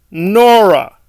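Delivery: a loud, drawn-out "Nora."; mocking name drop. Real, not acted. Vlogger sneers at Nora as the expert, loud and mocking. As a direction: land "Nora." as the joke.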